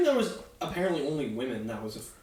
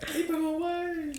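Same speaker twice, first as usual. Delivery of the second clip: high, slow frightened cry; terrified plea; 14 semitones higher, slower than usual. Pretend. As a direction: beg in mock terror.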